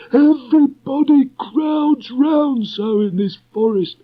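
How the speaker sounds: very high, slow, put-upon donkey grumble; Eeyore, cross. Acted. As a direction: grumble at the crowd like a huffy old donkey. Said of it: "turned round angrily".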